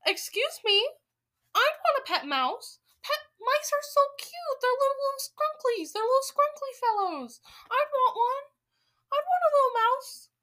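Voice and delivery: very high, loud, squeaky gushing; put-on high-pitched baby-talk squeal; cutesy pleading witch. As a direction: gush over adorable little mice. Invented witch wants a pet mouse.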